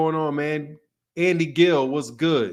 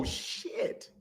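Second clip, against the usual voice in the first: whispered curse; 13 dB quieter than usual. Real, not acted. Reacting to something on screen.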